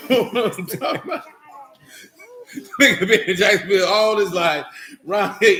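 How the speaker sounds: laughing. Real, not acted. Cracking up over Johnson wanting a roll, laughing. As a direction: crack up as you say it.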